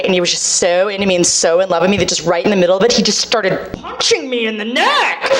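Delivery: normal talking voice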